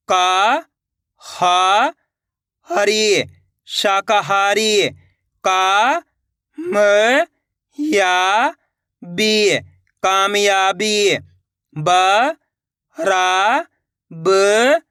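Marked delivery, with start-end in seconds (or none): 3.7-4.9 slowly joined syllables; slow blend. Shown as a demonstration. 10.0-11.2 slowly joined syllables; slow blend. Shown as a demonstration.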